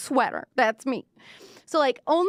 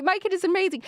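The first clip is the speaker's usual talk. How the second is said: doting parent; 11 semitones higher, faster than usual. Retold or imitated. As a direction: gush like a parent who thinks their kid is perfect. Doting parent bragging about child.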